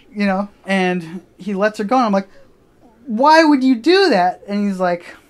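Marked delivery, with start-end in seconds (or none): 3.1-4.4 high, loud, slow drawn-out question; acted-out outraged disbelief; exasperated demand. Friend to the kid who grabbed the cousin, exasperated. Demanding an explanation after attack.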